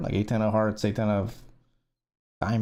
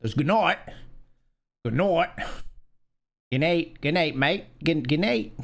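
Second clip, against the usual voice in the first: higher sing-song "Good night" in a vague mock accent; playful guessing at an accent; 5 semitones higher than usual. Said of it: "there's no accent that says it that way".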